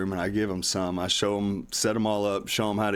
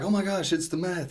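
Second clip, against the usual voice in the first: mock excited; 8 semitones higher than usual. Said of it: "they're all excited".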